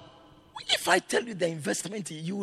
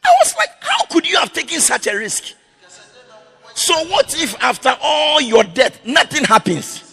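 loud, high, rising preacher's cry; fiery sermon climax; preaching at full force; 9 semitones higher, 10 dB louder than usual. Real, not acted. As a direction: preach it out, building to a shout.

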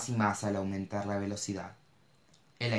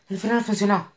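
high, loud relieved cry; relieved exclamation; 10 semitones higher, 5 dB louder than usual. A narrator's reading. Mo exclaims to Cash as the car stops. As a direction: burst out in relief. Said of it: "exclamó".

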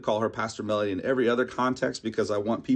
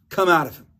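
firm, raised command; stern command; 5 semitones higher, 3 dB louder, faster than usual. Acted. Jesus orders the impure spirit out, stern command. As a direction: cast the spirit out with authority. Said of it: "said Jesus sternly".

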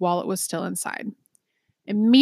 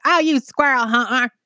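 hammed-up rural twang, higher and louder; country accent; 5 semitones higher, 5 dB louder than usual. Shown as a demonstration. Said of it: "my best country accent".

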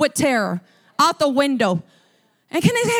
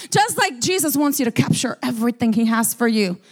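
ordinary talk, nothing marked

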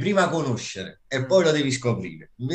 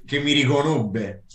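usual speaking voice

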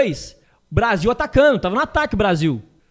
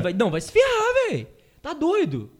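indignant retort; 8 semitones higher, 3 dB quieter than usual. Retold or imitated. Angry retort retold.

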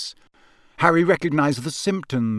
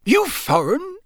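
high character voice, sharply rising question; Mister Roberts; 12 semitones higher, slower than usual. Acted. Campsite keeper quizzing an odd customer, high-pitched. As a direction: ask suspiciously, pitched high.

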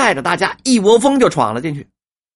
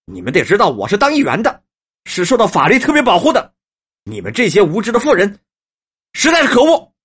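emboldened bluster; 3 semitones higher, 4 dB louder than usual. A narrator's reading. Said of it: "只得大着胆子说".

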